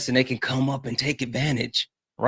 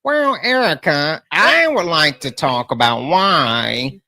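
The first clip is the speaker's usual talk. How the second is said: higher, louder mocking whine of a rival; Liam impression; 5 semitones higher, 4 dB louder than usual. Streamer mimics rival Liam pestering Erica, whiny mock voice. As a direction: needle her by playing the rival streamer. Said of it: "I do a good Liam".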